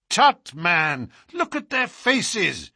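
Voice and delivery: slow, high, curt rebuke. Acted. Holmes curtly rebukes the doubting inspector, high and slow. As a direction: snap it out impatiently. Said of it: "cried Holmes curtly".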